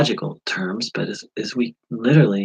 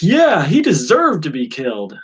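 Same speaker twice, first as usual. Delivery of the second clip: louder, higher gloating; ego voice agreeing; 6 semitones higher, 7 dB louder than usual. Retold or imitated. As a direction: relish the thought. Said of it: "Or I've been like".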